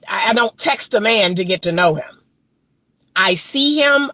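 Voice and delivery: ordinary talk, nothing marked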